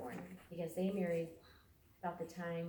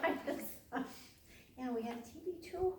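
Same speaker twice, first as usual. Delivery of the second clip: quick words through laughter; laughing; 7 semitones higher than usual. Real, not acted. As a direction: giggle while you say it. Family laughing at gymnastics news.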